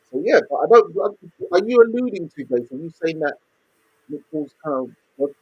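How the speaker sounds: muffled, dull line sound. Real, not acted. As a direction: voice muffled as if through a wall. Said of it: "You sound muffled".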